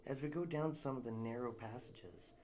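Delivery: ordinary delivery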